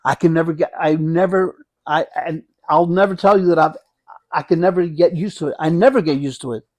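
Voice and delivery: ordinary talk, nothing marked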